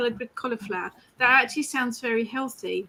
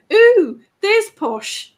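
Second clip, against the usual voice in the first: mock impressed; 9 semitones higher, 9 dB louder, much slower than usual. Real, not acted. Reacting to fancy salad order.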